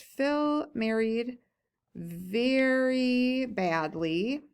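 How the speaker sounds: very slow, stretched-out words; writing-along drawl. Real, not acted. Teacher writing a note on married life, dragging each word. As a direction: draw each word out as you write it.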